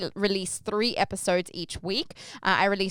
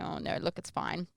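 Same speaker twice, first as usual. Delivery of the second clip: hushed reassurance; 2 semitones lower, 10 dB quieter than usual. Retold or imitated. Comedian reassuring her.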